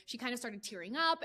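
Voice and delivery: usual speaking voice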